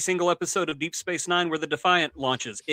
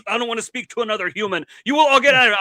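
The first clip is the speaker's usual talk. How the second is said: louder, higher indignant outburst; angry refusal; 4 semitones higher, 7 dB louder than usual. Acted. Cardassian commander refusing to speak to humans, angry. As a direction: snap at the viewscreen in rage.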